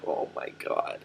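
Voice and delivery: hushed, shocked aside; whispered oh my god. Real, not acted. Reader whispering in shock at the donkey's slurp. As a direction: breathe it out in disbelief.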